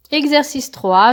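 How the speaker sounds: normal talking voice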